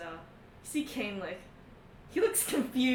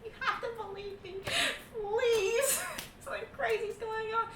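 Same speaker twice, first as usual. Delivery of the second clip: desperate plea; 8 semitones higher, 5 dB quieter than usual. Acted. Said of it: "And Mikhail's like".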